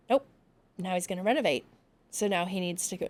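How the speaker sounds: ordinary talk, nothing marked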